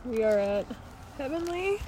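hoarse, barely any voice left; raspy whisper. Real, not acted. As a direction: voice worn out by trail dust. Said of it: "I no longer have a voice".

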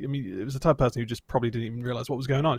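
normal talking voice

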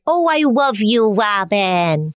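hammed-up Elmer Fudd style baby voice; silly baby-talk voice. Imitating a mother telling her grown child she loves her, silly baby-talk voice. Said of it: "in a silly voice".

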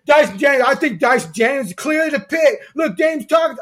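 mock a whiny eighth grader with a loud whine; mocking whiny fan voice. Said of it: "I know, I do the voice".

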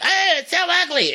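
high, far-off mocking voice of the internet; shrill mock disgust; mock catty gasp. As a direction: sneer it up high like a gossip. Everyone mocking the weave.

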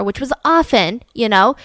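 normal talking voice

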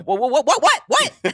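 rising, climbing what-what-what; high incredulous repeated what; shocked disbelief. Retold or imitated. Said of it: "I'm like".

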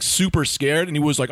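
ordinary delivery

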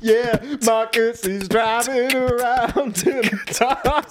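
high, slow, shouted race call; over-the-top commentator. Pretend. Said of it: "What is this?".

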